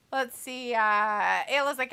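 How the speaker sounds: usual speaking voice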